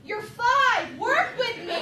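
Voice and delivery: put-on loud, high, pleading with the kids; mock exasperated plea to five-year-olds. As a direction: beg them to play along.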